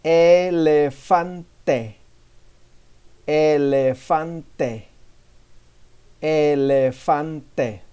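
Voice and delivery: Elefante drawn out slowly, syllable by syllable; slow teaching demo. Shown as a demonstration. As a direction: say Elefante very slowly for students to copy. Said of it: "Don't forget to repeat after me".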